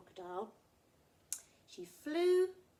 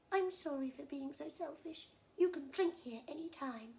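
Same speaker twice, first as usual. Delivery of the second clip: higher, louder crocodile making amends; apologetic crocodile; 7 semitones higher, 7 dB louder than usual. Acted. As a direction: offer a friendly apology in character.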